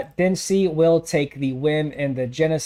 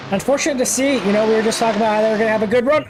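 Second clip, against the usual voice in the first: rising fast into a loud call; excited play-by-play; 6 semitones higher, 3 dB louder, faster than usual. Acted. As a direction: call the crash as it hits. Live call, crash building.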